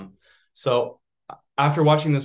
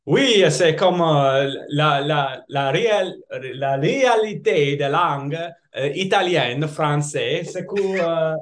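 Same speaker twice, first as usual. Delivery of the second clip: French with a higher, louder Italian lilt; Italian accent in French; 5 semitones higher, 4 dB louder than usual. Shown as a demonstration. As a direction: speak French like an Italian newcomer. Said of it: "could you give me an example".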